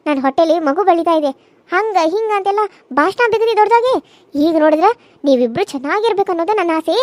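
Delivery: ordinary delivery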